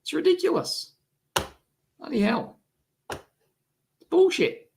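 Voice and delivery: normal talking voice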